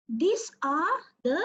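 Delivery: ordinary talk, nothing marked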